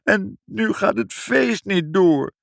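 slow, high-pitched wail; snivelling child. A narrator's reading. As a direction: drag it out like a blubbering child. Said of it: "snotterde Ibbeltje". (snotterend, langgerekt en hoog jammerend)

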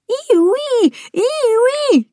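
very high, drawn-out seagull screech; screeching gulls. Acted. The gulls crying over the beach, high screeching. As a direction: shriek it like gulls circling overhead. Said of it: "krijsen ze".

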